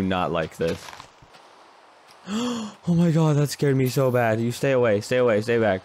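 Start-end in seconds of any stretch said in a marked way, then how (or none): 2.3-2.8 a high, startled intake of breath; a sudden fright gasp from the jump scare; sharp scared gasp. Real, not acted. 2.8-5.8 loud, high frightened yell of stay away; a scared player shouting at a monster to stay back. Real, not acted. Jump scare, warding off monster.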